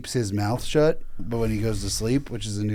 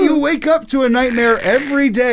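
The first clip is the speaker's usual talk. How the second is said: high, loud, laughing yell; laughing shout; 10 semitones higher, 8 dB louder than usual. Acted. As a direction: shout it while cracking up.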